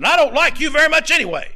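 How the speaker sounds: high, loud mock scold; imitating a yelling wife. Neighbor's wife yelling at the preacher, loud mock scold. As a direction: shout it like the angry neighbor's wife. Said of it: "she just kept yelling at me".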